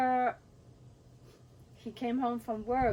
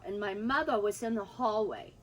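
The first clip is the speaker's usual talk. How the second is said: ordinary talk, nothing marked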